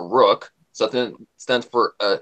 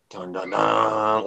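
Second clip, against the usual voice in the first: slow ominous music hum; much slower than usual. Acted.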